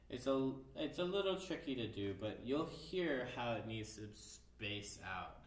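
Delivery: ordinary talk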